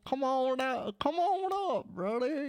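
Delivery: put-on a Canadian faking a Southern accent; mock Southern accent. Canadian friend hollering a bad Southern accent. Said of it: "You are too Canadian to sound Southern".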